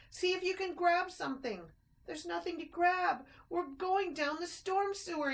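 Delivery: high, loud panicked shouts; panicked yell. A narrator's reading. Ted going down the sewer, high panicked yell. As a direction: yell over the rushing water. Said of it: "yelled Ted".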